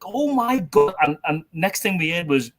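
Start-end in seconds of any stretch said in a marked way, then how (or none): 0.0-0.9 high, stunned exclamation; horrified. Retold or imitated. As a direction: recoil in horror. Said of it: "I was like".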